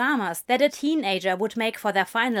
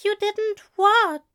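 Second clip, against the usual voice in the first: higher, slow gentle question; gentle angel; 11 semitones higher, 5 dB louder than usual. A narrator's reading. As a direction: coax it out of him. Angel gently presses the demon.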